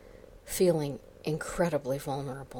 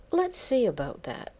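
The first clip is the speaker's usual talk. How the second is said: higher, bright smiling tone; 8 semitones higher, 5 dB louder than usual. Acted. Boss smiling at Karen's denial, a touch teasing. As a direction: say it with a sly smile. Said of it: "he smiled sincerely".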